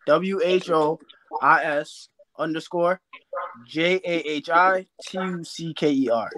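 spelled out slowly with pauses. Real, not acted. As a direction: give each letter time so people get it. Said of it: "say that slower one more time".

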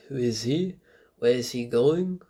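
imitation of an actor lapsing into French; French accent. Reviewer mimics Ben Affleck suddenly going French, mock French accent. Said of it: "he'll just go off French".

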